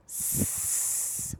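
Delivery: hissed s sound alone. Shown as a demonstration. Teacher demonstrating the letter sound, a long hissed s. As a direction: hiss a long s for the kids. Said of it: "قولي لنا الساوند بتاعه".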